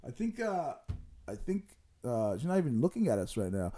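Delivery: ordinary talk, nothing marked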